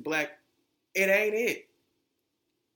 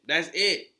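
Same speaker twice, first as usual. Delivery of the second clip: louder emphatic repeat; 2 semitones higher, 10 dB louder than usual. Real, not acted. Podcast host insisting to listeners, loud. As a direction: punch "That's it" much louder.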